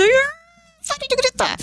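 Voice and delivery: grito agudo de mãe sem paciência, mais alto no tom; vontade de gritar. Retold or imitated. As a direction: explode de impaciência com o filho. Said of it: "de coração, dá vontade de". (grito de mãe exasperada)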